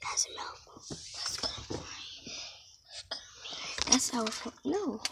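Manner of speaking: hushed whisper in a closet; whispering while hiding. Real, not acted. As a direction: whisper so the seeker can't hear.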